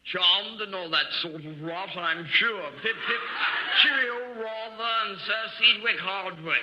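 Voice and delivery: hammed-up posh British accent, lightly done; a hint of a jolly British toff accent; mock upper-class British accent. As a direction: play a cartoon English aristocrat in a British accent. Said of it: "watch your accent".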